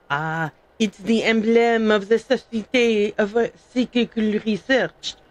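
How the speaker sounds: hammed-up French accent, reading in character; amateur mock-French accent; fake French accent. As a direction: put on a bad French accent. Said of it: "That was a terrible fake French accent".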